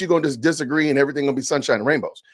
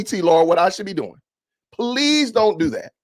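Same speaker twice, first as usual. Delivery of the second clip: normal talking voice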